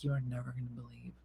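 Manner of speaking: whispered fast complaint; muttered aside. Retold or imitated. Missy muttering to Jeremy about Dina, whispered and fast. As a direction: gossip under breath in a hurry. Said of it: "She's like".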